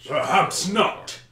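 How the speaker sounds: slow, drawn-out villain voice; orc leader's challenge. Acted. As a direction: answer slowly and loudly as a battle foe. Orc leader Akar answers across field.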